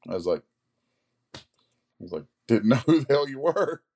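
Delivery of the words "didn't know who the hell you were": higher, louder through a laugh. Real, not acted. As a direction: retell it while cracking up. Laughs while retelling the exchange.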